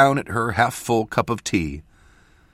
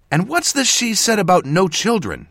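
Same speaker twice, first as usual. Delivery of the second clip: high, loud, accusing question; pressing; 13 semitones higher, 6 dB louder than usual. A narrator's reading. Apprentice interrogating mentor about children, pressing. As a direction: push for an answer, indignant. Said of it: "Jess pressed further".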